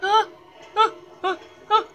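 high stuttering uh with a laugh; nervous stammering. Real, not acted. Player freaking out on the bridge, high nervous stammer. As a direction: stammer in panic, half laughing.

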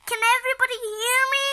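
high, loud imitation of a yell. Showing how May yelled at everybody, loud yell. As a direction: shout it out like the girl in the picture. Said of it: "that she was yelling".

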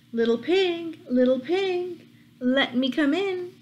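high, coaxing wolf voice. Acted. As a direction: sweet-talk the pig like a sly wolf. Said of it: "Then along came the wolf and said".

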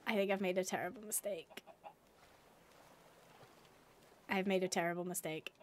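low, quiet dawning regret; sinking realisation. Real, not acted. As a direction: mutter it low as the mistake sinks in. Realises the swim was a mistake.

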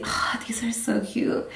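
hushed whisper of delight. Real, not acted. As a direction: whisper it to yourself.

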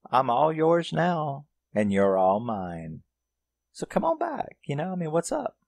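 imitated come-hither taunt, rising to falsetto. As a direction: lure him back in a high teasing voice. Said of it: "starts taunting him".

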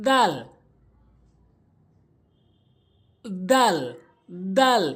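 emphatic দাল, tongue tip against front teeth; letter dal demo. Shown as a demonstration. Teacher asking learners to watch closely, careful দাল. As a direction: strike a clear, drawn দাল off the front teeth. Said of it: "দাঁতের আগাতে লাগাতে হবে".

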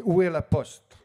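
loud, high, clear teacher prompt; teacher drill. Retold or imitated. Teacher drilling refugee class with loud prompt.

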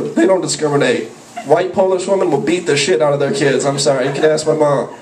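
normal talking voice

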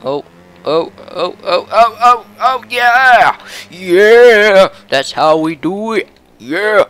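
building oh oh into loud yeah; triumphant whoop. Real, not acted. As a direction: cheer as if you just won. Celebrating an overtake.

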